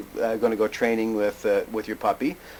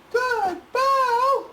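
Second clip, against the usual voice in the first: very high, delighted praise; squeaky happy praise; dog praise; 4 dB louder than usual. Real, not acted. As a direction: gush over the pup. Praising the dog right after.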